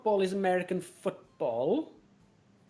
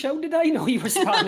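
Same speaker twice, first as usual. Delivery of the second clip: ordinary talk, nothing marked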